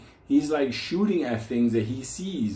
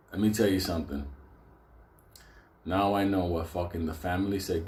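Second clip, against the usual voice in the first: ordinary delivery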